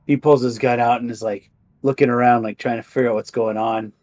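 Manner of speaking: ordinary delivery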